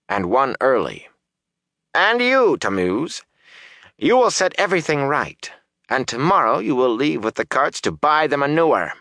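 high-pitched woman giving orders; commanding Aludra. A narrator's reading. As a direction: lay down orders, then scold and threaten. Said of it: "Aludra's voice changed in answer".

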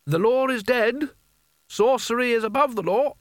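calling out. A narrator's reading. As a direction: call it up and out, pitch climbing. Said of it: "the same voice called out".

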